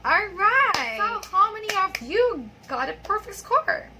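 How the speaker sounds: rally the learners with excitement; excited. Real, not acted. Rousing check of quiz results.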